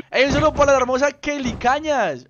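excited stream shout-out. Real, not acted. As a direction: hype up the viewer.

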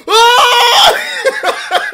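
very high, loud excited cheer; crowd roar. Retold or imitated. Fans erupting when the girls kiss, high loud cheer. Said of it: "and it was like".

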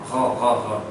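r, r, r rolled deep in the throat; gargled French r. Shown as a demonstration. Teacher drills the gargled French r for students. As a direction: gargle the r, r, r from the back. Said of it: "original sound of R".

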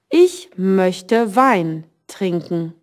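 slow, careful German read for learners; slow teacher demo reading; slow model sentence. Shown as a demonstration. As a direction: spell it out slowly for the class. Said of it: "Isabella's sentence was".

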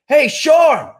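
high, loud call across the field; retold yell out to a worker; yelling a name. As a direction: shout for Sean from far away. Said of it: "They yell out".

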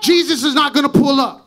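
loud, rising preacher's call; full-force preaching. Acted. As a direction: drive it home to the congregation.

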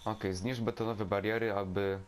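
ordinary talk

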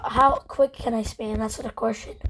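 usual speaking voice

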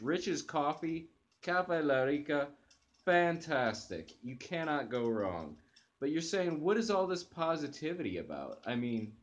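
usual speaking voice

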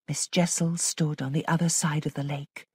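usual speaking voice

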